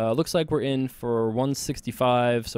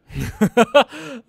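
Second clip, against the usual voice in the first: high laugh; 12 semitones higher, 10 dB louder than usual. Real, not acted. Opponent folds instantly.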